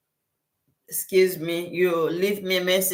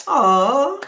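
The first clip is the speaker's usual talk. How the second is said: high, loud delighted oh; surprised oh; 9 semitones higher, 7 dB louder than usual. Real, not acted. Host seeing a viewer's gift in the chat, a loud surprised oh. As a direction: gasp it out in happy surprise.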